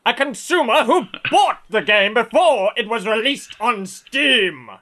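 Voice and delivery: high, loud, put-on indignant squawk; Clone 2727's voice. Host mocks listener Clone 2727 with loud high voice. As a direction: play the outraged entitled customer, loud and high. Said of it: "which is how he talks".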